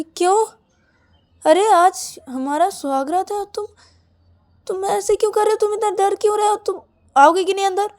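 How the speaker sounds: high-pitched, indignant questioning; exasperated protest. A narrator's reading. Bride reminding partner it is wedding night, exasperated.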